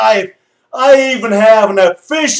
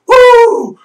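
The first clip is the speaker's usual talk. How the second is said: high-pitched "woo"; a quick, high cheer; celebratory whoop; 11 semitones higher than usual. Real, not acted. Fan cheering the team's move home, whooping.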